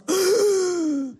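sharp high gasp; startled high gasp. Retold or imitated. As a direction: gasp in shock. Shocked by God's rebuke.

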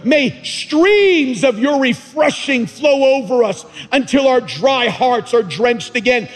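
loud, high revival preaching; preaching at full force. Real, not acted. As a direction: preach it out to the crowd.

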